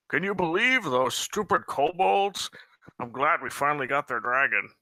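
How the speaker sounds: high, sneering goblin voice. Acted. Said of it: "speaking in a goblin voice".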